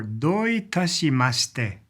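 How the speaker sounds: Doitashimashite said slowly, syllable by syllable; teacher spells out Doitashimashite slowly for learners; slow careful Japanese. Shown as a demonstration. Teacher models Doitashimashite slowly for English-speaking learners. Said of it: "You may have heard it before".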